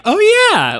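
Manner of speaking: sudden high excited yelp; excited exclamation. Retold or imitated. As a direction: blurt it out with sudden recognition. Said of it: "I kept going like".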